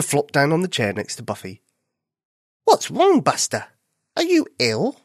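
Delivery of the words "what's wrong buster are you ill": a high, worried bunny voice; concerned. Acted. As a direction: ask with real worry. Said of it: "concerned for his cousin".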